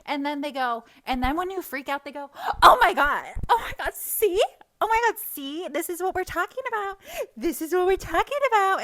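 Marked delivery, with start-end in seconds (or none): 2.6-8.8 loud, high-pitched hysterical imitation; mock horror. Imitating critics when she freaks out, mock horrified. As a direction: shriek it like a scandalized accuser. Mimicking their reaction to her freakout.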